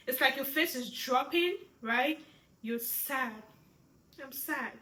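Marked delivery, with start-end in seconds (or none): 2.6-4.7 soft, downcast demo; sad. Shown as a demonstration. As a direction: let it drop softly, sadly. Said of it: "your facial expression has to show".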